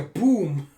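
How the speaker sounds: a loud boom, fall at the end; mock boom. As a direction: hit it like an impact. Sound effect for palm strike.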